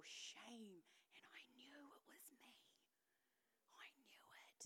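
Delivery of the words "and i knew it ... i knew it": barely voiced whisper; hushed admission. Real, not acted. Woman confessing to the congregation it was her, hushed. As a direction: drop right down to a whisper.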